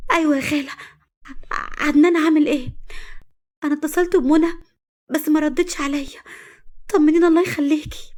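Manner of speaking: worried fiancée asking on the phone about him; anxious girlfriend. A narrator's reading. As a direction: plead anxiously for news. Anxious young woman on phone.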